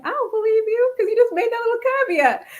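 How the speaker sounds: high, fast, teasing disbelief; skeptical retort. Retold or imitated. To Spaniards who excuse her, quick high skeptical retort. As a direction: fire back that you don't buy the exception. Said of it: "I'm like".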